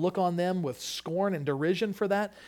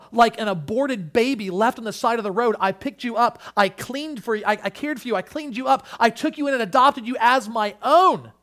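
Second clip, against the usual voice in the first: raised, higher voice, building to the end; impassioned preaching; 7 semitones higher, 8 dB louder than usual. Acted. God reminding Israel of his adoption, impassioned preaching. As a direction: plead God's love with growing force.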